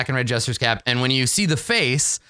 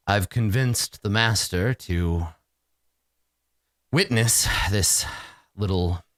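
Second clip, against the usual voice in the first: ominous mistress; 4 semitones lower than usual. Acted.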